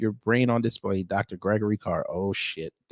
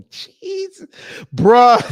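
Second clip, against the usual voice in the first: high, loud burst of laughing disbelief; cracking up; 8 dB louder than usual. Real, not acted. Reacting to a joke about glasses, cracking up. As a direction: blurt it out through real laughter.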